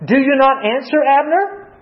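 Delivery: taunting call. Retold or imitated. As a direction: call out to Abner mockingly. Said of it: "kinda taunts him a little bit".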